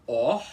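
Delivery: "or" with a breathy start and a trilled R; rolled R demo. Shown as a demonstration. Teacher showing the airy start and trilled R of "or", loud. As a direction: push a little air, then trill the R in "or". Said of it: "fazer o arzinho".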